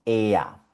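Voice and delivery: "ea" as an E gliding into A; E-R sound demo. Shown as a demonstration. Teacher recalling how E with R sounded, clear "ea". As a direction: model "ea" with both vowels heard. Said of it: "te dije que sonaba".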